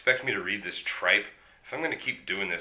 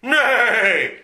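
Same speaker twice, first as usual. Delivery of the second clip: loud, high whinnying "Nay!"; the horse Dodger whinnies in protest; horse neigh; 12 semitones higher, 10 dB louder than usual. Acted. Horse attacks the villain.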